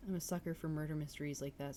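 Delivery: normal talking voice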